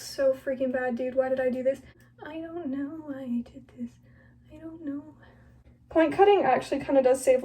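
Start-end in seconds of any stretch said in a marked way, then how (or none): 2.3-5.1 whispered regret. Real, not acted. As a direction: murmur it ruefully, barely voiced.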